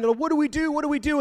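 high frantic What do we do; panicked sailors crying out. Retold or imitated. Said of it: "and they're crying".

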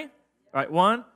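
usual speaking voice

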